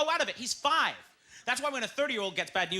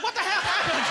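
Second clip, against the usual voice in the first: high, loud incredulous question; mock disbelief; 10 semitones higher, 5 dB louder than usual. Comedian grilling talk show guest, mock disbelief. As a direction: exclaim in exaggerated astonishment.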